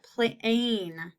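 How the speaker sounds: plain pulled apart at the long A; slow, sounded-out plain; stretched long A. Shown as a demonstration. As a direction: draw out the A in plain slowly. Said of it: "is in that second syllable".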